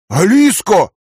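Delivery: loud, high alarmed cry; exclaiming. A narrator's reading. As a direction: call her name out in alarm. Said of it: "воскликнул он".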